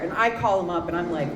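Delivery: ordinary delivery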